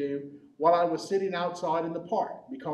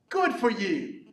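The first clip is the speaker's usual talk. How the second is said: put-on mother congratulating her child, higher and louder; higher, louder motherly well-done; praising mother; 5 semitones higher, 5 dB louder, slower than usual. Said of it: "a mother would ask her child".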